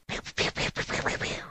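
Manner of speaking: whispered pew pew gunfire; gunshot pew sounds. Retold or imitated. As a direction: fire off quiet pews. Imitating gunfire.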